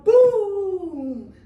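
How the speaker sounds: loud booming thunder. Shown as a demonstration. Teacher leads kids in loud thunder booms. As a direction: drop a big boom with the arm. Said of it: "let's start with our thunder".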